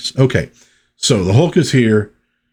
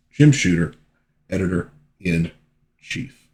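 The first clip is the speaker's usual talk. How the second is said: plain reading voice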